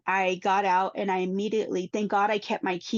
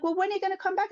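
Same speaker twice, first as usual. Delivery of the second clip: put-on higher, asking like a small child; child's voice; 11 semitones higher, faster than usual. Children asking their mother when she returns, higher child voice. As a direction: voice the little one asking.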